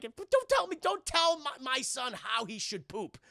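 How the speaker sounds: high, loud mock-mom outburst; indignant scolding. Mother scolding Shooter McGavin about her son, indignant. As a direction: tell him off, voice shooting up.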